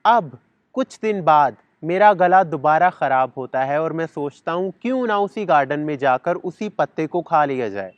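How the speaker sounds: ordinary talk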